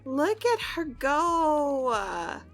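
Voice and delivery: proud, elongated whoop of encouragement; excited cheer. Real, not acted. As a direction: cheer her on, stretching the words out. Cheering on her climbing character.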